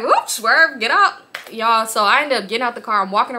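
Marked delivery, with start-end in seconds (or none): 0.0-1.1 loud, higher playful getaway; fleeing the spot, gleeful; quick escape. Retold or imitated. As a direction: bolt out gleefully. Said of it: "I'm like".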